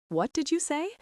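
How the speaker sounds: a startled, high-pitched demand; shocked. Acted. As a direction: pitch jumps high in disbelief. James shocked by insult.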